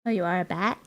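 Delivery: ordinary talk, nothing marked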